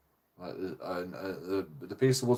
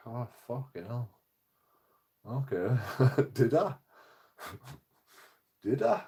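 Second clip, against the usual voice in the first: soft, dropping, laughing at his own trap; defeated little laugh; rueful laugh; 5 dB quieter than usual. Retold or imitated. Man told he admitted assault, quiet rueful laugh. Realising he was tricked.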